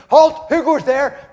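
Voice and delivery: high, loud sentry challenge; German soldier challenging a noise in the dark, shouting; German guard's shout. Acted. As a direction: bark it out like a guard on the wall. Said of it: "The Germans said".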